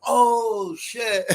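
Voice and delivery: high startled "oh, shit"; a sudden recognition, half shouted; surprised outcry. Retold or imitated. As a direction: blurt it out in shock. Trake recognizes the stabber.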